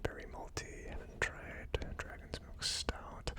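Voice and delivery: normal talking voice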